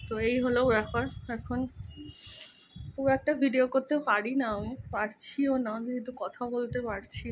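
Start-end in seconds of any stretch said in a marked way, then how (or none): none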